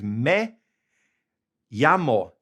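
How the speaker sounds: me llamo, the ll said as y, may YAH-mo; Spanish me llamo. Shown as a demonstration. Teacher modelling how to say my name is. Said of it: "there is".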